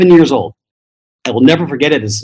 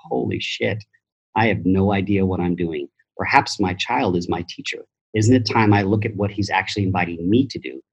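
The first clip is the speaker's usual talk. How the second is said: hushed epiphany; 7 semitones lower, 8 dB quieter than usual. Retold or imitated. Retold quiet epiphany.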